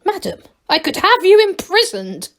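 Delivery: furious Duke roaring. A narrator's reading. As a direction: bellow the threat. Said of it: "roared the Duke".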